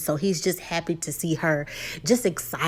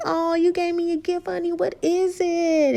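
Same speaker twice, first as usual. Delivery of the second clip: mock sweet wife; 9 semitones higher than usual. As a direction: coo it sweetly like a delighted wife. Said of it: "she's like".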